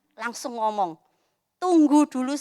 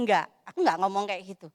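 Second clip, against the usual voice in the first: usual speaking voice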